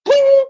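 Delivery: sudden high, loud ping; toaster ping. Retold or imitated. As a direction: pop up like a toaster with a bright ping. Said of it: "I'm gonna talk like a toaster".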